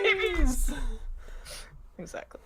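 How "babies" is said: squealing delight. Acted. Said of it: "It's like".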